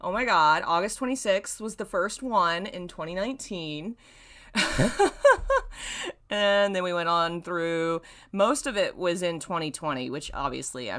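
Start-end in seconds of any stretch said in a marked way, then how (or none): 4.5-6.3 sudden high delighted laugh; high burst of laughter. Real, not acted. Laughing to chat at the anniversary date, high and delighted. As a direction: crack up at the surprise date.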